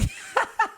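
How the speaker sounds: sudden high breathy giggle; laugh. Real, not acted. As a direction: burst out laughing at her own joke. Jokes about forgetting partner's name.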